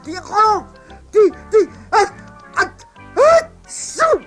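high strained build-up to a huge sneeze; ah... ah... tchou, a big cold sneeze. Acted. Grandma with a cold sneezing out her grandson's name. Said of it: "cria-t-elle".

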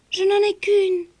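high worried admission; girl short of money; young girl. Acted. Rosabelle avoue. (jeune fille inquiète, voix aiguë)

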